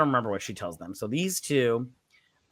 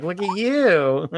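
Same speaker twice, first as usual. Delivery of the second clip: teasing; 6 semitones higher, 4 dB louder, much slower than usual. Retold or imitated. Teasing man in Speedo.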